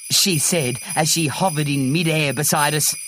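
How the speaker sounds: ordinary delivery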